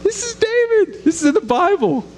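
high-pitched, marvelling aside; incredulous and high; excited. Real, not acted. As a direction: sound amazed that it's scripture.

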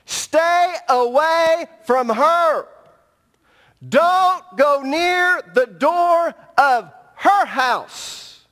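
slow, loud and high, each word hammered; preaching at full force. Acted. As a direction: thunder the warning from the pulpit. Preached scripture reading.

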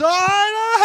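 very loud, high idiotic cackling; hammed-up dopey yell breaking into guffaws; mock stupid reply. Preacher mocking a clueless believer's answer, loud stupid laughing. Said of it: "I'm not walking around stupid".

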